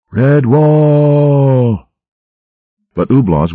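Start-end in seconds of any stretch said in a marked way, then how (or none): 0.1-1.9 much higher, louder drawn-out battle cry; war cry roar. A narrator's reading. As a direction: roar the name as you charge down the stairs. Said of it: "he roared".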